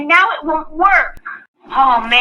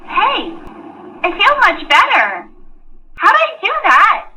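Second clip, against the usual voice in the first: ordinary talk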